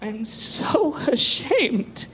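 a slow, hammed-up actress sigh with a laugh in it; mock actress line. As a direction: overplay the shame like a bad actress. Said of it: "And then they go".

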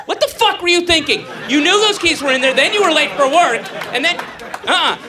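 high, loud self-scolding rant; chastising yourself. Acted. Man yells at himself over the locked keys and being late. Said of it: "you're chastising yourself, going".

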